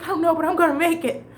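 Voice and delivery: high, fast, half laughing half crying; laughing through tears. Real, not acted. Telling the manager she will make it, laughing and teary. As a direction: blurt it out while laughing and near tears. Quitting her job, laughing.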